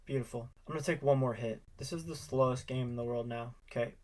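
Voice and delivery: ordinary talk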